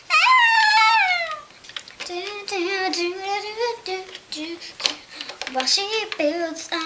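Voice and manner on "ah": sudden high squeal; high shark shriek. Pretend. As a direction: yelp like a bitten toy. Shark cry.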